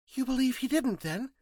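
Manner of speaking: put-on very high, eager young woman's question; eager plea for confirmation. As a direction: ask it hopefully, pitched right up. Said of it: "she asked eagerly".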